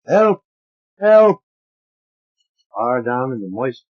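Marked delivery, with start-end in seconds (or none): 0.1-1.4 high, loud shout for help; a voice trapped in the body calling for help; desperate cry for help. A narrator's reading. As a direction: scream for rescue from deep inside. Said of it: "a new voice is calling deep in me".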